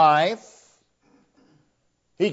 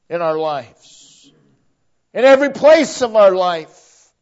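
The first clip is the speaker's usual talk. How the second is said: rising preacher's build, growing louder; preaching build; 4 semitones higher, 5 dB louder than usual. Acted. Pastor driving home Christ's lordship, rising to full preaching. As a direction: build each phrase toward the climax.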